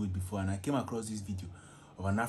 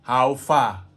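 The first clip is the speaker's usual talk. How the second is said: "How far?" thrown out loud in an African accent; quick loud African accent greeting, Nigerian style; 3 semitones higher, 14 dB louder than usual. Shown as a demonstration. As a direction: African accent, punchy and playful. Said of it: "using my African voice".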